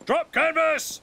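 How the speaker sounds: captain barking to drop canvas; shouted order. Acted. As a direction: bark it to the crew.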